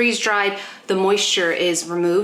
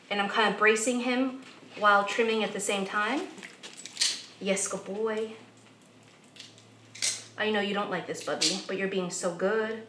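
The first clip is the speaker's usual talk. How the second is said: soft soothing voice; 10 dB quieter than usual. Real, not acted. Soothing dog while trimming.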